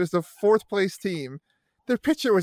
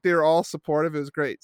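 ordinary talk, nothing marked

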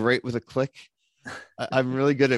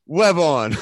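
higher, louder mock superhero call; mock command; 6 semitones higher, 5 dB louder than usual. Acting out Spider-Man trying to shoot a web, mock command.

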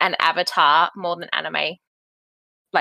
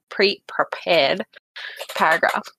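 deliberate slow whisper; 2 semitones lower, slower than usual. Real, not acted. Friend savouring her prepared intro, slow hushed. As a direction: whisper it slowly, word by word. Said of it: "this beautiful pre-preca".

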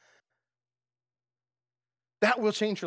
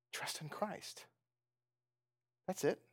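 a soft whispered answer after a long pause; 13 dB quieter, slower than usual. Real, not acted. Preacher hushes the room to give the answer, whispering. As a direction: breathe it out like a secret.

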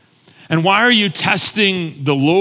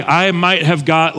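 normal talking voice

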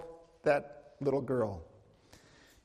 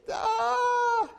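pained cry. Retold or imitated. Injured daughter crying out.

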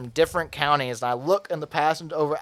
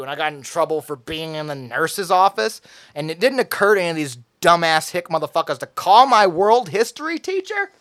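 ordinary delivery

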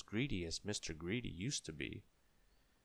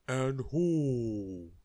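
loud, drawn-out giant's roar; thundering giant; 5 semitones higher, 8 dB louder than usual. A narrator's reading. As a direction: boom it out like a huge giant. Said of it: "thundered the giant".

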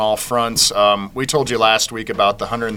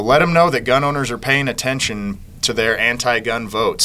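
normal talking voice

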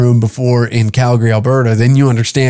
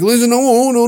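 pitched-up panicky no, no; high defensive protest; 11 semitones higher than usual. Retold or imitated. Accused of racism, protesting much higher, flustered. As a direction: squeak out a flustered denial.

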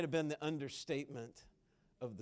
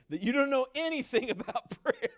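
laughing through the words, higher and louder; 9 semitones higher, 8 dB louder than usual. Real, not acted. Preacher laughing with the congregation about prayer. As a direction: chuckle it out at your own joke.

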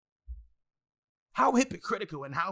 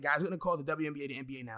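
usual speaking voice

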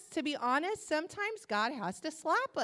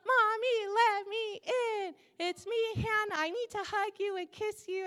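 put-on little girl's singsong; 8 semitones higher than usual. Three-year-old daughter begs mother to let her in, high singsong. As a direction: sing it like a small child begging. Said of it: "She has a little song".

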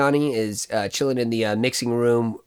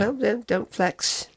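slow, lightly voiced [name], teasing; a hint of [name], dry and slow; 9 semitones higher, 4 dB quieter, slower than usual. [name] teases Michael for correcting her, lightly voiced.

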